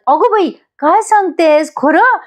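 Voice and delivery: high, delighted gasp of wonder; mother thrilled by the son-in-law's song, amazed and high-pitched. Acted. Mother hears son-in-law sang, amazed high exclamation.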